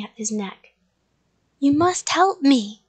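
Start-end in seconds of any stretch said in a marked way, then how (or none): 1.6-2.8 loud, urgent monster plea; urgent demand. A narrator's reading. Monster tugging at his neck to child, urgent. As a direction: plead forcefully for help.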